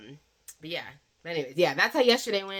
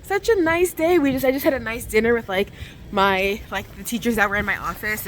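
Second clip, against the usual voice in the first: loud outdoor chatter; 6 semitones higher, 9 dB louder than usual. Real, not acted. Talking loudly walking outdoors.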